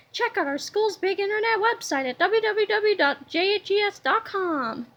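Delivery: quick promo aside; ad read. Acted. Narrator slipping in a school website plug, ad read.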